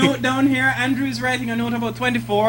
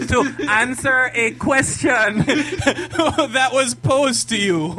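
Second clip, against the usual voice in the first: drawn-out, laughing mock apology; sarcastic apology; 2 semitones higher, much slower than usual. Real, not acted. Teasing a co-host who wouldn't answer the question, sarcastic. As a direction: spell it out slowly, breaking into laughter.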